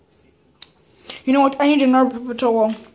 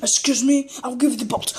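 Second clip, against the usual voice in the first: ordinary delivery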